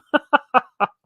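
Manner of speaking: high burst of laughter. Real, not acted. Laughing at his own naked-viewer joke, high giggle. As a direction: crack up high.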